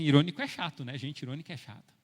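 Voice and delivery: ordinary talk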